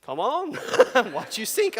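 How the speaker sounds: high, teasing taunt with a laugh; mock taunting ghost. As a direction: tease him like a spooky trickster. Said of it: "What the ghost could have been like".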